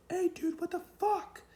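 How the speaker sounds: very high, loud mock yell; outraged officer. Officer hit by a thrown joint, outraged. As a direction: squawk it like an indignant cop.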